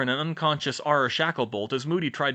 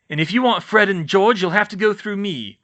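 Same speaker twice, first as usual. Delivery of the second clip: defiant; 7 semitones higher, 8 dB louder than usual. Acted. Defiant challenge.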